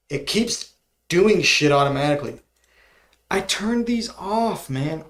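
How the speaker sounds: venting at the device that won't listen; real irritation, building; annoyed rant. Real, not acted. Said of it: "I'm so mad right now".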